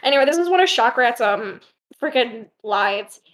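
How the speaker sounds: usual speaking voice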